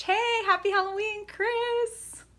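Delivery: excited greeting. Real, not acted. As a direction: chirp a happy Halloween. Greeting a live chatter by name.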